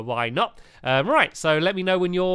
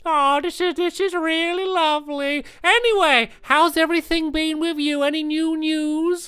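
raised-pitch playful lizard voice; gecko character voice; 13 semitones higher, 4 dB louder than usual. Acted. Gecko greeting his old friend Betsy, raised playful character voice. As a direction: play the fussy old gecko catching up.

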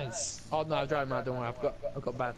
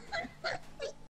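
very high, softer wheezing laugh; silly laugh; 8 dB quieter than usual. Real, not acted. Friend spent after the selfie joke, silly wheezing laugh. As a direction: laugh yourself out, squeaky and thin. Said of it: "That fucking laugh is stupid".

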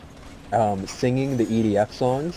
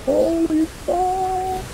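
very high, slow, stretched-out shocked cry; shocked exclamation; much slower than usual. Real, not acted. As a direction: drag it out in shock. Startled by something in game.

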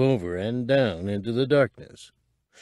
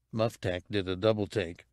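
ordinary delivery

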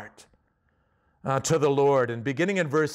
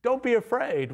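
higher, gentle hush of comfort; reassuring God; 8 semitones higher than usual. Pretend. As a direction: soothe them like a kind father. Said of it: "God showed up and said".

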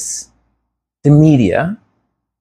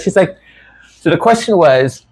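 ordinary talk

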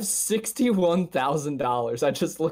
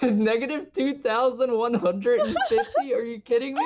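laughing disbelief; 10 semitones higher than usual. Real, not acted. Disbelief at damage number.

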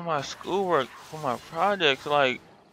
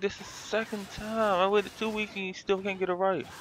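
exasperated; 7 semitones higher, 4 dB quieter than usual. Retold or imitated. Retold frustration at repair shop.

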